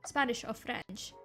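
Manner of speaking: usual speaking voice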